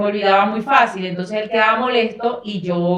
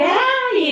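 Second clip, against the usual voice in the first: quejido agudo fingido. Retold or imitated. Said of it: "como que".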